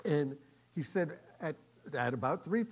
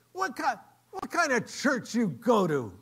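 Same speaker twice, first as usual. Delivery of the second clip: high, loud, incredulous question; challenging demand; 8 semitones higher, 7 dB louder than usual. Retold or imitated. Diner owner grilling the man about church, challenging.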